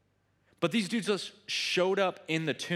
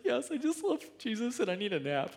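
higher, silly put-on voice of a tired coworker; mock weary coworker; 7 semitones higher than usual. Pastor imitating coworker Nathan in a staff meeting, in a mock weary voice. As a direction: play it like a worn-out staff member brushing off concern. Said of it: "Nathan's like".

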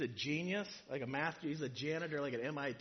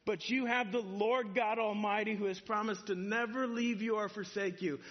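rousing encouragement; 6 semitones higher, 5 dB louder than usual. Retold or imitated. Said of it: "What he's saying is like".

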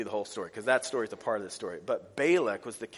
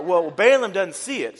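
ordinary talk

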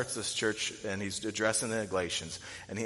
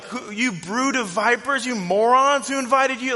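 brash and bold; 14 semitones higher, 10 dB louder than usual. Retold or imitated. John the Baptist to the Pharisees, bold brash shout. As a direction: shout the insults boldly at them. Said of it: "brashly addresses them".

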